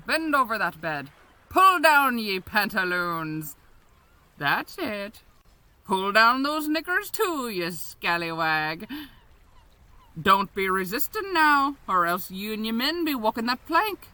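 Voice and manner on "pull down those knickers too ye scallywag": ordinary talk, nothing marked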